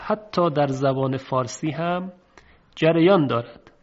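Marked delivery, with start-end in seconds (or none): none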